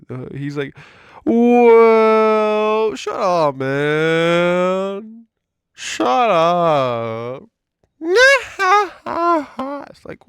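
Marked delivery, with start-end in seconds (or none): 1.2-7.4 loud, higher mock yell of the boss; mock shout. Imitating manager silencing him. 8.0-9.9 high, loud put-on laugh; mocking laugh. Mock laugh after imitation.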